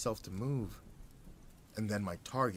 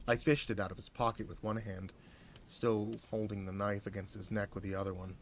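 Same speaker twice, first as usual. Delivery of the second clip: usual speaking voice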